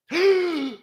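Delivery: loud, high acted gasp; shocked gasp. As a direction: gasp as if horrified by what you see. Reenacting a juror seeing the image.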